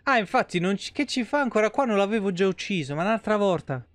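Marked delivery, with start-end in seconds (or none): none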